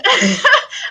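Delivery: high, loud laugh; burst of laughter. Real, not acted. Agent breaking up over a client's confession, real laughing fit. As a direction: crack up at your own story. Cracks up telling own story.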